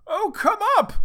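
very high, loud call; girl's voice. A narrator's reading. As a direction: call down as a squeaky-high girl. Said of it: "and it was a girl's".

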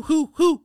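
high, loud owl hoots. A narrator's reading. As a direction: hoot like an owl, high and ringing. Owl's call in the story.

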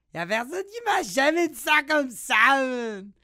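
put-on high, loud immigrant street accent; immigrant-slang accent. Protesting to immigrant friends, loud immigrant street accent. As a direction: exclaim it high and stretched in immigrant-youth slang. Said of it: "avec mes amis, euh, un peu immigrantes".

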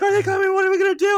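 high, loud mock-tearful wail; crying panic. Hunched-down friends asking what to do, crying in panic. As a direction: imitate two friends wailing in panic. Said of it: "like, both crying".

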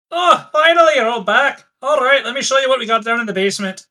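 high, loud Scottish greeting, then quick; a hearty Scottish welcome, rushed at the end; Scottish accent. Acted. GM as Manda greeting the returning party in a Scottish accent. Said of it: "dreams about Scottish accents".

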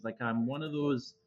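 ordinary delivery

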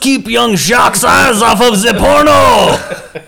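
put-on loud, high French accent; French chef. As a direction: ham up a French accent, shouting. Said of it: "He's like".

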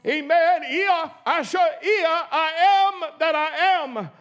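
shouted, drawn-out praise; fervent exclamation. Real, not acted. Preacher exulting over the divine name, shouting. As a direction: cry it out in fervor.

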